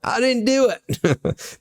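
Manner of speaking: high, slow Alabama-meets-Wembley drawl; a muddled fake accent, played for laughs; mock Southern-British accent. Co-host parodies the actor with a mixed-up accent. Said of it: "That was Alabama by way of Wembley".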